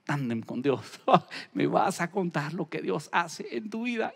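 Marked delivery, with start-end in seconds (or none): none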